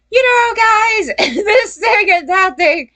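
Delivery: mock falsetto, very high and put-on. Mocking a singer's opposite fake voice, high falsetto impression. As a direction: squeal it in a fake high-pitched voice, drawn out. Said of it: "[name], I guess you could look at. He did a whole opposite thing".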